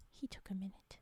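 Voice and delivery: soft whispered aside; whispering. Real, not acted. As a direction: breathe it out to yourself. Unvoiced aside.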